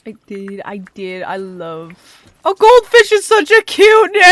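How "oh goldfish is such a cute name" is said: high, loud delighted gushing; excited squeal. Real, not acted. Gushing over pet's name.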